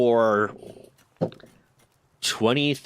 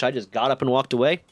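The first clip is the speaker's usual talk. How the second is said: ordinary delivery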